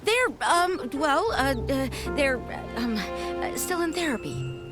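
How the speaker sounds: halting, flustered hedging; evasive. Acted. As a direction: stumble through fillers, searching for an excuse. Evasive about patients in therapy.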